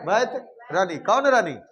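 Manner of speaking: ordinary delivery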